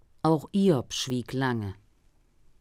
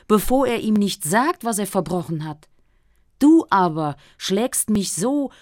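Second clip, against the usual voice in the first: louder, higher lament of a desperate man; pleading Job; 5 semitones higher, 6 dB louder than usual. Acted. Job crying out to God for one answer, desperate and loud. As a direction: demand an answer, anguished and rising.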